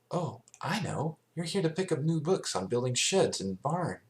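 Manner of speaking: bright guess, sure she's got it; Twilight voice. A narrator's reading. Twilight guessing why Big Mac came, eager.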